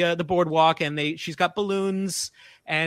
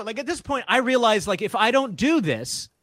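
usual speaking voice

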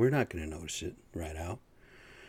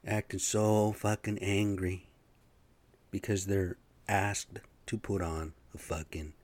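usual speaking voice

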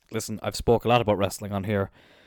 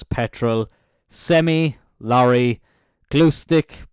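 usual speaking voice

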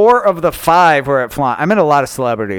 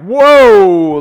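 startled; 5 semitones higher, 9 dB louder than usual. Retold or imitated. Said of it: "And I'm like".

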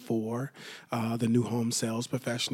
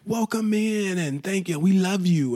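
high, bright over-the-top greeting, much higher; gushing welcome; 7 semitones higher, 4 dB louder than usual. Retold or imitated. New sandwich shop worker greeting a customer, gushing. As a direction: gush like an eager new employee. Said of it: "the service is amazing".

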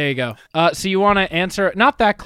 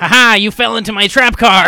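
loud mock-villain triumph; gloating; 3 semitones higher, 9 dB louder than usual. Host gloats that guest fell for it, mock triumph. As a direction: crow loudly over the sprung trap.

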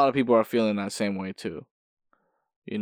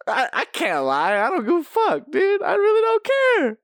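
acted giddy confession. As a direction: pitch climbing to a very high squeal. Acting out gleeful confession of apathy.

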